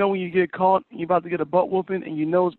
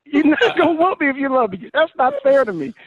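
put-on protesting kid; 7 semitones higher than usual. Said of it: "I'm like".

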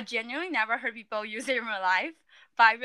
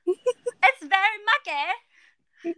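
fake British accent; 8 semitones higher, 4 dB louder than usual. Shown as a demonstration. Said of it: "your fake British accent again".

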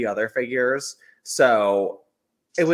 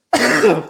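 sharp choking coughs; coughing fit; 9 semitones higher, 9 dB louder than usual. Real, not acted. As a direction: cough it out hard.

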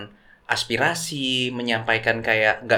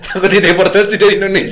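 laughing; 7 semitones higher, 8 dB louder than usual. Real, not acted. Joking about mixed heritage.

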